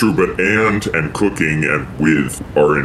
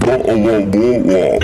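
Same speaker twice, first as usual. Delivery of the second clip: mock British accent; slower than usual.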